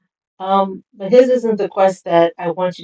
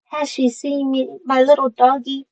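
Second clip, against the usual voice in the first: high, put-on tiny girl voice; little girl voice; 7 semitones higher, 4 dB louder than usual. Tiny girl begging for her lost doggy, high little voice. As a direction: lift it high like a little child babbling. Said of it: "I'm sorry for my little voice".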